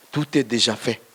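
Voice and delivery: ordinary talk, nothing marked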